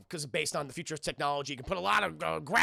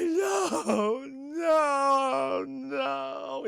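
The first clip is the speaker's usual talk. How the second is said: mock sobbing; 10 semitones higher, 4 dB louder, much slower than usual. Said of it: "[name]'s just sobbing".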